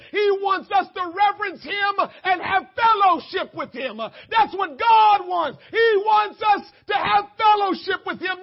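loud, high, emphatic sermon shout; full-force preaching. Real, not acted. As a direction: proclaim it urgently to the whole church.

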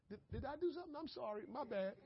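high, soft, sheepish little apology; meek apology. Acted. To someone who hit him hard, a high soft sheepish apology. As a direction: play the timid pushover saying sorry.

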